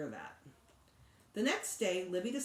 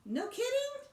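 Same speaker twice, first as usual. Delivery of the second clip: much higher, wide-eyed surprise; surprised; 3 dB louder than usual. A narrator's reading. Sheila amazed at what Mom says, high surprise. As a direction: lift it up in amazement.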